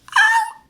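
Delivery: sudden high squeal of delight; high shriek. Retold or imitated. Shrieking as she retells the comeback. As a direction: shriek like the burn just landed. Reacting to her own burn.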